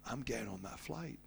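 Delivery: quiet resolve. Retold or imitated. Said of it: "I held it in my hand and I said".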